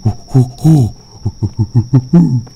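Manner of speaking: monkey hoots. Pretend. Imitating monkey again.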